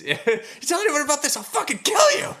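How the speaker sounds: high, loud, furious yelled threat; screaming threat. Retold or imitated. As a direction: scream it like you mean to kill. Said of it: "another screaming line".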